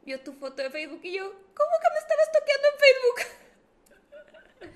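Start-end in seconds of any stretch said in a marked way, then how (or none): 1.2-4.7 high, loud, drawn-out squeal; outraged disbelief. Retold or imitated. Daughter caught through her Facebook photo, mock outraged. Hija indignada al saberse descubierta.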